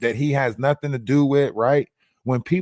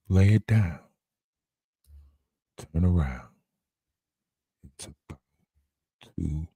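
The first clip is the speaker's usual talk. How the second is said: deep, soft, slow crooning voice; deep lulling bedroom voice; 8 semitones lower, 7 dB quieter, slower than usual. Pretend.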